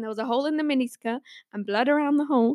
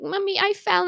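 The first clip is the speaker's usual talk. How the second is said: put-on whimpering child; 8 semitones higher than usual. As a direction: tell mummy like a hurt kid. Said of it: "I was like".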